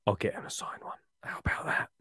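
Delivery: whispered aside. Real, not acted. Whispered aside about signing.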